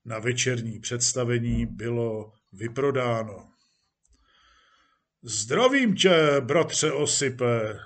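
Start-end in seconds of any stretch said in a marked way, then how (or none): 5.2-7.8 calling out. Acted. Police chief greets actor Osip, calling out. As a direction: call a greeting across to someone. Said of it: "zvolal hejtman".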